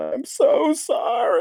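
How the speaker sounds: high, slow, tearful mock sob; crying apology. Caught puking in a booth, a high, slow, teary apology. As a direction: whimper the apology through tears. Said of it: "I looked up, and".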